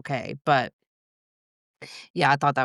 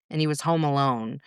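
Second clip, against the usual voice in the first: ordinary talk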